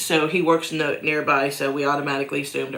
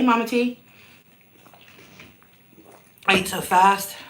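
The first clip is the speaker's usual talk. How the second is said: usual speaking voice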